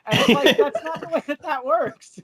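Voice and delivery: high, laughing protest. Real, not acted. Co-host cracking up after mimicking a wrestler, laughing.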